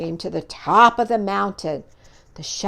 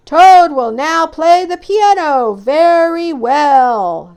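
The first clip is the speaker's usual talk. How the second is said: high, slow, loud announcer; booming showman's announcement; strange far-off voice; 6 semitones higher, 9 dB louder, slower than usual. Acted. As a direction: announce it grandly from afar. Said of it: "said the strange voice".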